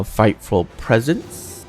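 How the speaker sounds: ordinary delivery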